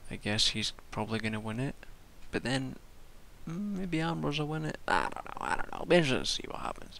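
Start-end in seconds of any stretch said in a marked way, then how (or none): none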